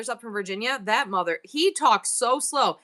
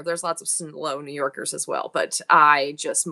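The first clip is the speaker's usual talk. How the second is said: normal talking voice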